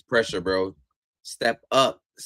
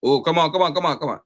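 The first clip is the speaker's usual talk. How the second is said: frustrated; 7 semitones higher, 6 dB louder than usual. Real, not acted. Frustrated at a football match.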